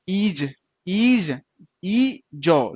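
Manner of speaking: ईज stretched out very slowly, twice; drawn-out demo. Shown as a demonstration. Teacher sounding out ईज for students, very slow and stretched. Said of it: "ध्यान से sound दीजिए".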